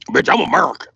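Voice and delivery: punchy, forceful repeat; emphatic retort. Acted. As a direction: punch it out with attitude. Same line repeated forcefully.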